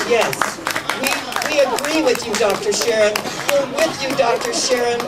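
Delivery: high, loud, joyful cosmic chorus; booming, delighted voice of the universe. Acted. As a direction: speak as the whole universe cheering her on. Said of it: "the universe creates this amazing energy field and says".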